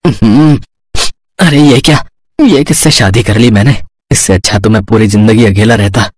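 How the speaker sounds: low, dismayed muttering to himself; regretful grumble. Acted. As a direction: lament the marriage in a sunken voice. Husband grumbles at night about wife.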